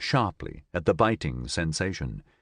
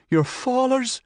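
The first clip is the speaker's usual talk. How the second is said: high, louder terrified cry; horrified accusation; 3 dB louder, slower than usual. Acted. Cassandra to Nigel, high horrified accusing cry. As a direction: scream the accusation in fear. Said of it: "she cried".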